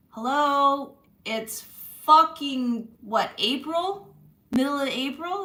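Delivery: loud, outraged complaint about the weather; hello, it's April, come on; exasperated rant. Real, not acted. Ranting about cold April weather.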